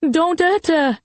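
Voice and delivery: high-pitched cry, not full volume; mother's scream. A narrator's reading. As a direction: scream in protest, high but contained. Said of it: "Mum screamed".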